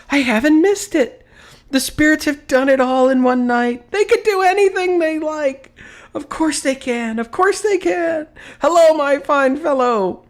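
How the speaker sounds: very high, loud exultant delight; overjoyed. A narrator's reading. Scrooge realising it's still Christmas, exultant. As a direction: exult that he hasn't missed it.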